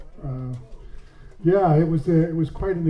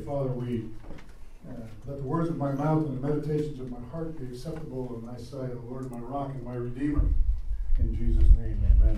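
soft, hushed prayer voice; 5 semitones lower, 9 dB quieter than usual. Acted. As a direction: pray quietly and reverently. Leads a prayer.

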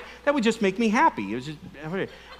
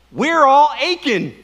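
slow and loud, each word leaned on; emphatic preaching; 5 semitones higher, 5 dB louder, slower than usual. Acted. Preacher proclaims the key line to the congregation, slow and emphatic. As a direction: drive the line home to the room.

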